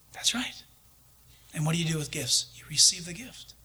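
soft whisper; hushed whisper of reassurance. Retold or imitated. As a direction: whisper it close and gentle. Gentle answer to the man.

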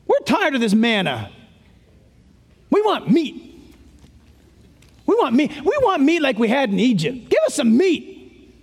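mock whining, pitch jumping up on the demands; whining complaint. Israel coming out of Egypt demanding meat, mock whining. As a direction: play a griping, fed-up crowd. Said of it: "remember what their complaint was".

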